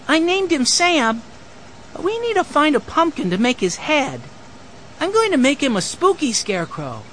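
put-on high-pitched six-year-old voice; small boy chattering to grandfather; eager little boy. A narrator's reading. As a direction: pipe up high, proud of his scarecrow.

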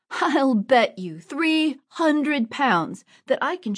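put-on furious boy staking three hundred pounds; angry challenge. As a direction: spit out the bet, each word deliberate. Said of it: "Robin grew very angry".

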